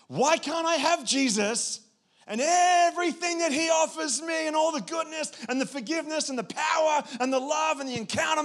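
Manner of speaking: high, loud, over-the-top begging; greedy pleading. Acted. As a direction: plead dramatically as if demanding to have everything. Acting out wanting it all.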